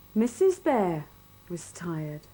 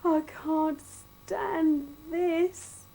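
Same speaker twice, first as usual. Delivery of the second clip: slow, high exasperated groan; fed-up bear; 9 semitones higher, 3 dB louder, much slower than usual. A narrator's reading. Mister Bear kept awake by garden noises, slow groan. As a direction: stretch it out wearily. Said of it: "said Mister Bear".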